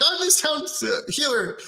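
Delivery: mock yell in a very high voice; Aqua. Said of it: "just start yelling at them".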